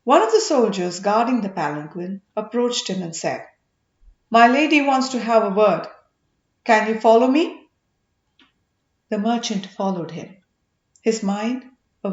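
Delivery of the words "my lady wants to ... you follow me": soldier summoning. Acted.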